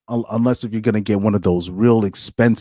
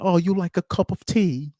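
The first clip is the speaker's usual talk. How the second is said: a hammed-up fake British accent, pitched higher; lightly mock a posh British tea offer; imitating a British accent badly and higher; 9 semitones higher than usual. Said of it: "they be like".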